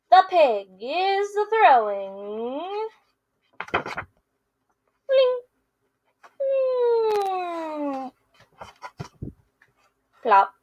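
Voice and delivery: lively picture-book read-aloud with character voices; reading to children. Acted. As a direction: read it to kids, playing both friends. Teacher reads picture book aloud.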